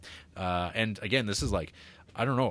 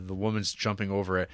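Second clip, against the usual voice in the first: ordinary talk, nothing marked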